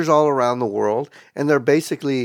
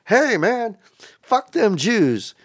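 higher-pitched, slow drawn-out mock voice; imitated weirdo; 6 semitones higher, slower than usual. Oddball walking into a meeting, imitated slow high voice. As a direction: draw it out high, playing an oddball. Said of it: "some weird fucker".

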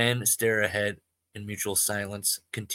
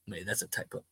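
ordinary talk

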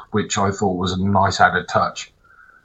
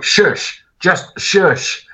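high, pleading hush; exasperated shush; 10 semitones higher, much slower than usual. Retold or imitated. Hushing nephew in cinema.

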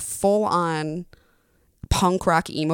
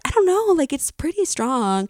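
skeptical friend; 8 semitones higher than usual. Retold or imitated. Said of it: "she's like".

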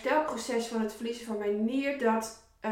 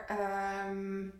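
ordinary talk

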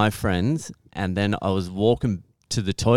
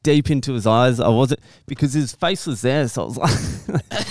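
ordinary talk

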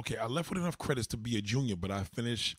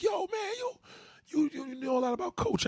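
high, stammering mimicry; Haitian admirers. Haitian people marvelling at him, high mimicked voice. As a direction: imitate an impressed fan in a high voice. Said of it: "they be like".

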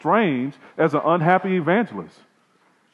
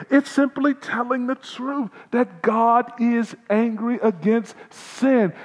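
slow emphasis; 10 semitones higher, 3 dB louder, slower than usual. Acted. Slowed emphatic sermon line.

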